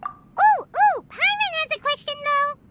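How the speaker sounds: put-on like a kid waving a hand to be called on; eager question. Paimon eager to ask something.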